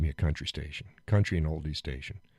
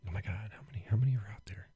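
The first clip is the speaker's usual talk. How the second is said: mock whisper; 10 dB quieter, much faster than usual. Mock panic at the engine count.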